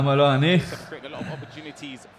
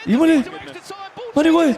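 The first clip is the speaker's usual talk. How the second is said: shocked disbelief; 12 semitones higher, much faster than usual. Real, not acted. Another shock on stream.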